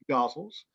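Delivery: "gozzles" said GOZ-ulz, first vowel open as in got; variant pronunciation. Shown as a demonstration. Poet showing listeners ways to name the form, "gozzles" said GOZ-ulz. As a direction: say "gozzles" to rhyme with nozzles. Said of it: "they can be pronounced".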